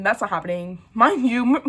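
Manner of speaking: usual speaking voice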